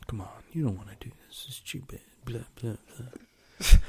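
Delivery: a quick, mocking whisper; whispered plea. Retelling the brother begging him to quit, in a quick whisper. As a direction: hurry it out under the breath, unvoiced. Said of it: "he's like".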